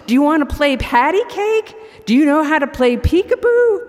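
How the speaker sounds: fast, high, loud call; shouting across the room. Acted. Old man calling to the baby across the room, fast loud shout. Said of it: "shouting across the room".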